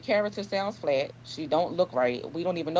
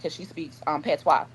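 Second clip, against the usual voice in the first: ordinary talk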